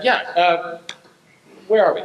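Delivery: ordinary delivery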